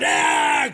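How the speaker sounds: high imitated movie shout; mock battle yell. Tony Montana coming out shooting, mock battle yell. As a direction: yell like an action hero blazing away.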